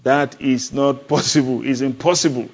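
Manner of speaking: normal talking voice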